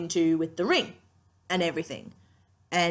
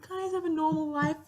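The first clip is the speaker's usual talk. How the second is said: higher, pleading voice, slowing on the last plea; pleading Waverley; 9 semitones higher than usual. Retold or imitated. Fan imitates Waverley begging for a normal life, higher and pleading. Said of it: "Waverly's like".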